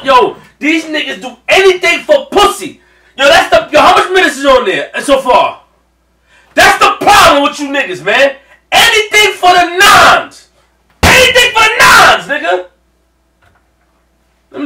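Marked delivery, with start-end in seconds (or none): 0.0-8.4 higher, louder rant; heated rant. Real, not acted. Fan ranting at Sanji for choosing marriage, heated rant. 8.7-12.7 mocking yell. Real, not acted.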